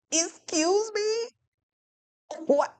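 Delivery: high, slow, put-on disbelief; indignant excuse me. Retelling doctor visit, high slow indignant excuse me. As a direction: stretch it out, high and affronted.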